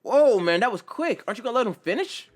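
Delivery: surprised buddy cutting in fast; startled interjection. Acted. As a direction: blurt it out in disbelief. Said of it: "Kirishima interjects".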